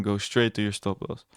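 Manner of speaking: ordinary talk, nothing marked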